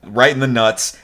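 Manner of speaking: usual speaking voice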